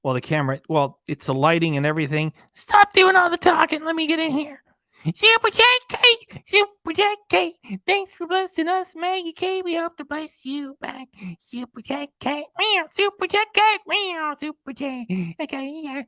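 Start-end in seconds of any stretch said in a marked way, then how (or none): 2.5-4.6 squeaky high cartoon cat. Cat puppet voice takes over. 5.1-16.0 squeaky high cartoon cat. Speaking as a cat character.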